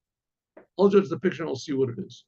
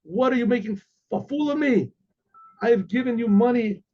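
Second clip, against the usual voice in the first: mock indignant yell; 5 semitones higher than usual. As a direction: act out an offended king's protest. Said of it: "yells out".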